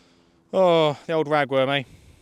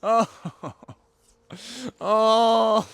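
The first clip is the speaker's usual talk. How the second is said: high dismayed oh; 9 semitones higher, 5 dB louder than usual. Real, not acted. Fish slips off the hook.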